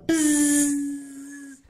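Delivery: high, slow buzzing like a swarm of bugs. Acted. Reader making the bugs buzz around the hippo, high and drawn out. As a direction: drag out a high insect buzz.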